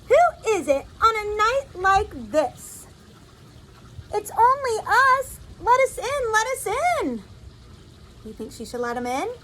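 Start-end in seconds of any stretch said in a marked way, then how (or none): 0.1-2.9 higher, animated call through a door. Acted. Woken woman asks who is at the door, calling out. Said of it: "she called". 4.1-7.2 high-pitched, pleading call from outside; voices shouting at windows. Acted. Visitors outside asking to come in, high calling voices. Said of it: "voices shouted at windows".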